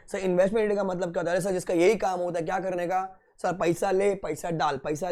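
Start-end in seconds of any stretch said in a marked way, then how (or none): none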